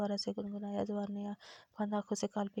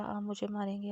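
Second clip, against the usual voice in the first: plain reading voice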